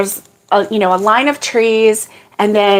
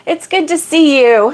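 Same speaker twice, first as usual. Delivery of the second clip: raised, friendly welcome; warm greeting; 6 semitones higher than usual. Real, not acted. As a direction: welcome them gladly. Greeting a viewer.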